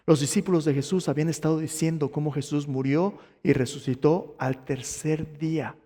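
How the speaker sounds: usual speaking voice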